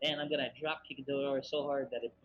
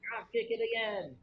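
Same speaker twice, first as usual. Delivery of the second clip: shouting; 8 semitones higher, 3 dB louder than usual. Real, not acted. Player urging another player.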